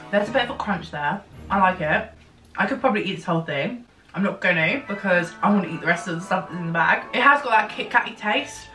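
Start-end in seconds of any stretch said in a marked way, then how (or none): none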